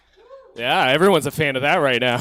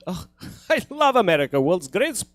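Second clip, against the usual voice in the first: higher and louder Russian-accented dad, hammed up; loud, high Russian accent for the dad on the phone; Russian father voice; 10 semitones higher, 9 dB louder than usual. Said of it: "Anytime he's on the phone with my grandfather".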